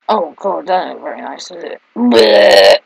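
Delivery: ordinary talk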